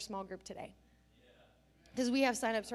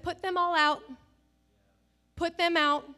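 louder, higher, forceful order; firm command; 7 semitones higher, 10 dB louder than usual. Retold or imitated. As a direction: order them out firmly.

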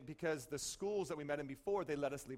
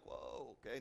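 low wary aside; 7 semitones lower, 8 dB quieter than usual. Retold or imitated. Bystander backing off.

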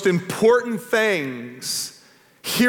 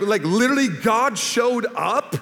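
higher, drawn-out disbelieving question; acted-out astonishment, pitched up and slowed; mock incredulity; 5 semitones higher than usual. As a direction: stretch out each phrase as if baffled. Acting out his younger incredulous self.

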